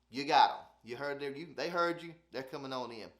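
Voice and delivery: usual speaking voice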